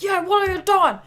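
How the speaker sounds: high, hammed-up challenge; mock angry owner. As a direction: play the owner shouting at trespassers. Voices the owner.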